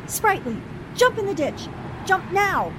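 urgent high shout to jump clear; shouted warning. Acted. Annie shouting to Sprightly as the car charges, urgent and loud. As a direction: yell at him to jump, right now.

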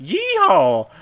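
loud, high mock-Texan whoop; Texan yell. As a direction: holler it like a cartoon Texan. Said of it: "a southern accent outside of, like, Texas".

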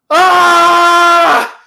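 full-voiced, high-pitched mock scream; losing it. Retelling Jack clearing the party from his house, hammed-up scream. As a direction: scream it as an acted-out meltdown. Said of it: "Jack just loses it".

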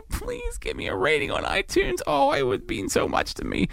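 tiny, high, whimpering plea; mock begging. Podcaster begging listeners for a rating, squeaky mock plea. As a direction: beg in a squeaky, pitiful little voice.

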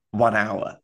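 ordinary talk

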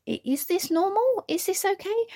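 high, anxious questioning; worried thought. Retold or imitated. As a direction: panicky rising questions. Panicked inner thought, high pitch.

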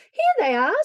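put-on high, loud boyish shout; excited scream. A narrator's reading. Davy finds his mittens, screaming with excitement. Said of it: "screamed Davy".

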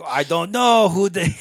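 exaggerated Canadian French impression; Canadian French accent. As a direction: imitate a hammed-up Quebecois. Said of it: "his Canadian French accent".

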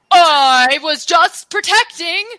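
high, loud protesting mouse; defensive blind mouse. Acted. Blind mouse protests when accused.

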